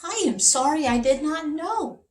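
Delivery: high, apologetic king; king's voice. Acted. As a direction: say it like a contrite little king. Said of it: "said the king of Puka Puka".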